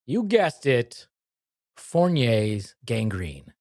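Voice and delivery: very slow, weighty reveal; slow dramatic reveal. Acted. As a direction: drag out the big reveal.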